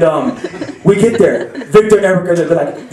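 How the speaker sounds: normal talking voice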